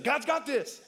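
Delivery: Sunday-morning faith restored; triumphant faith. Retold or imitated. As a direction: declare it bold on Sunday. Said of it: "Then Sunday".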